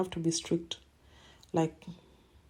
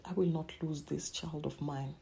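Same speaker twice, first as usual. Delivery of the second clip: soft, unvoiced whisper to herself; whispered vow; 2 semitones lower, 7 dB quieter than usual. Mother retelling her vow to save her daughter, whispered. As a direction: hush it out as a fierce private promise. Retold vow in dark moment.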